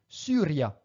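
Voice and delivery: "Syria" with rounded French u for the y. Shown as a demonstration. Teacher showing upsilon in Latin, "Syria" with a French u. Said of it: "ne se prononce pas I, attention, mais bien U".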